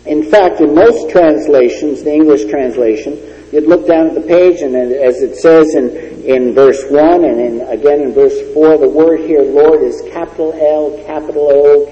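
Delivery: ordinary talk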